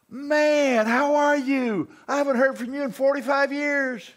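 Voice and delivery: loud, high, stretched-out greeting; delighted old friend. Acted. Old roommate hears from Bill after decades, acted delight. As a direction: act out an overjoyed reunion.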